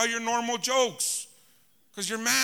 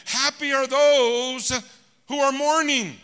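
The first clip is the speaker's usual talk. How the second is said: slow emphatic preaching; 5 semitones higher, 4 dB louder, slower than usual. Acted. Preacher stressing odd verse.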